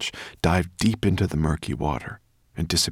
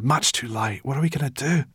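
moaning; 8 semitones higher than usual. Acted. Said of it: "Sorrel moaned".

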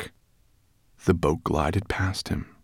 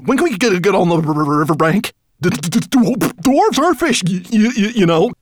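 high, loud, chattering-teeth stammer; shivering stutter; 13 semitones higher, 7 dB louder than usual. A narrator's reading. Soaked dwarf begging his master for the riverbank, shivering stutter. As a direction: stutter urgently through the cold.

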